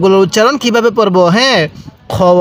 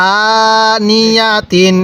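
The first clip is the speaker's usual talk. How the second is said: very slow with the vowels drawn out; আনিয়াতিন recited very slowly; slow drawn reading; much slower than usual. Shown as a demonstration. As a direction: draw out আনিয়াতিন syllable by syllable. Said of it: "কিভাবে পড়ব".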